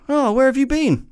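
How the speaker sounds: high, put-on comic voice asking; mock posh question. Quoting a comedy bit in a film review, high comic voice. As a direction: ask it in a high silly sketch voice. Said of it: "what's the Eddie Izzard thing where he goes".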